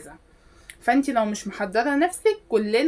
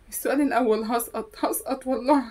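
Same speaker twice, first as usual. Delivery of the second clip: hammed-up sob, voice breaking; fake-cry about failing the exam; exaggerated comic weeping; mock crying student.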